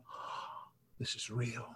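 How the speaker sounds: hushed, stunned whisper; breathless whispered awe; awed whisper. Retold or imitated. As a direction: whisper it in disbelief. Retold stunned realisation.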